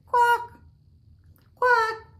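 squawky high quack, loud; loud playful duck call. Pretend.

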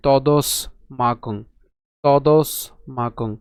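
তদস stressed, pause, মাকং; split-syllable demo. Shown as a demonstration. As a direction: break তদস্মাকং after the স. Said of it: "দন্তাস পর্যন্ত উচ্চারণ করছি".

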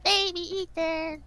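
put-on high, slow baby voice. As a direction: talk like a baby. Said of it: "can you still do your baby voice".

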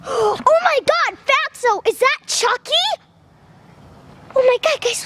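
gasping oh my God, pitched high; stunned discovery of a doll in a box; shocked. Real, not acted. Vlogger shocked to find Chucky in his dog's crate, high gasping surprise.

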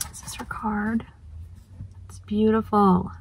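soft unvoiced whisper. Real, not acted. Vlogger showing her friend's card, whispering. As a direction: hush it like a secret.

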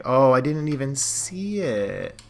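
loud, high, drawn-out cry of dismay; real dismayed outburst. Real, not acted. Reacts to missed ball in game.

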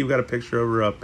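ordinary delivery

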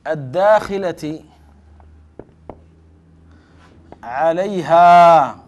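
definition dictated word by word, long pauses; slow dictation. Shown as a demonstration. Teacher dictating the grammarians' definition slowly. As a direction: spell out the definition slowly for the class to write. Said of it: "فقالوا".

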